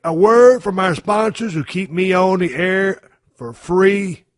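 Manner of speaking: upbeat sponsor pitch; ad read. Acted. Host reading a sponsor spot to his audience, upbeat and salesy. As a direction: sell the website builder to the listeners.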